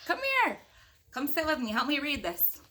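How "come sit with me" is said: ordinary delivery, nothing marked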